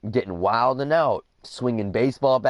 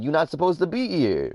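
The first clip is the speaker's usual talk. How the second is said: raised pitch, but no yelling; firmly telling off; 6 semitones higher than usual. Retold or imitated. Retold dad telling the cop off, firm not yelling. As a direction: lay down the law as Dad, without shouting. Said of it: "not yelling at him, just telling him".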